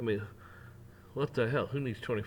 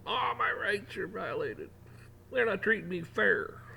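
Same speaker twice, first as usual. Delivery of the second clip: higher, slow whiny mockery; whiny mock complaint; 9 semitones higher than usual. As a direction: whine it like a hypocrite. Mocking a hypocrite's complaint.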